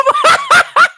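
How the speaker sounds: high, loud burst of real laughter. Real, not acted. Cracking up at own retort, loud high laugh.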